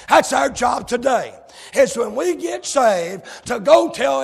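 loud, rising sermon cadence; full-force preaching. Acted. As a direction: preach it hard to the congregation.

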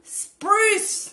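high, loud cry of "spruce"; exclamation. Shown as a demonstration. Guest showing how the slang is used, exclaimed high and loud. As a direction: cry "spruce" like a swear word. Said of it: "spruce is like".

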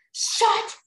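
sudden loud mock scream; over-the-top furious shout; yelling at brother. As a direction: explode at a sibling over nothing. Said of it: "whenever my brother makes this tiniest sound".

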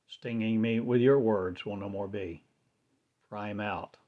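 normal talking voice

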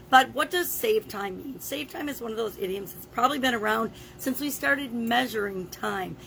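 ordinary talk

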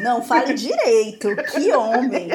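ordinary talk

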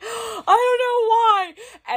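very high, loud, drawn-out wail; hammed-up helpless cry; mock panicked wail. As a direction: wail it slowly, imitating your tearful self. Acts out her tearful panicked answer.

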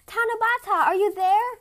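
high, loud searching call. A narrator's reading. Mirekan calling from the bamboo top, loud and searching. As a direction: shout up into the clouds. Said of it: "he shouted".

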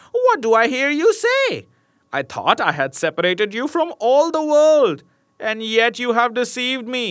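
high, loud furious witch voice; shrieking witch. A narrator's reading. Witch shrieks at Rapunzel for deceiving her, high angry cackle voice. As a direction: screech it like an outraged hag. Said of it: "cried the witch".

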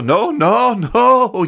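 half-acted scream of refusal; mock holler. Dying person refusing the news, hollering no. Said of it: "begins to scream and holler".

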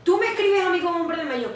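usual speaking voice

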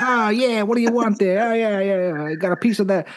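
high, hammed-up old-time ballplayer chatter. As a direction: ham up a cocky 1940s batter calling for his pitch. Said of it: "When everyone was talking".

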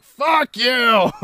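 high, loud retort; mock angry yell. Guy acting out the party confrontation, mock-angry yell. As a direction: hurl it as a hammed-up insult.

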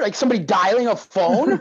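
ordinary talk, nothing marked

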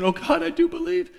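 mock cry. Cat owner cries out after his prayer, mock outcry. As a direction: cry out in imitation, thin and high. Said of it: "she just cried out without thinking".

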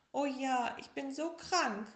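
high, loud whiny tiger voice; sick little tiger. Acted. Little tiger tells bear how sick he is, high and whiny. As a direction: wail it like a sick little cub. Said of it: "rief der kleine Tiger".

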